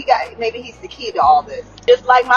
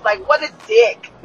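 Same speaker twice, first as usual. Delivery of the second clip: normal talking voice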